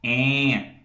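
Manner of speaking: nasal "em", held through the nose; nasal e vowel. Shown as a demonstration. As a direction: sustain a nasal "em". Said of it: "eu posso falar". ("em" nasal e sustentado)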